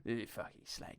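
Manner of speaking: very soft, low mock menace; half-whispered film hard man; hushed tough-guy insult. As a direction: growl it under your breath, barely voiced. Mimics the film's hard man.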